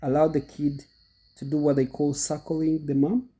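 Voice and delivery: ordinary talk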